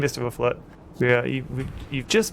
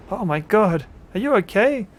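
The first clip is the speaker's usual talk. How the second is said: loud, high panicked questions; panicking; 9 semitones higher, 7 dB louder than usual. Acted. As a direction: fire off worried questions in panic. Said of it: "William Mayfield panics".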